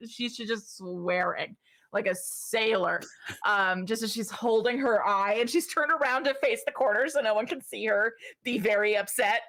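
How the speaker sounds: ordinary talk, nothing marked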